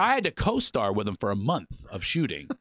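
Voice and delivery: usual speaking voice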